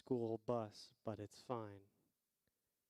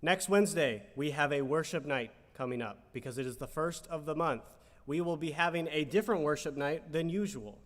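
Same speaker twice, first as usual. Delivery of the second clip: louder, announcer-style church news; mock news anchor; 4 semitones higher, 7 dB louder than usual. Co-host reads the worship night news like an announcer. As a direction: read the notices like a TV anchor.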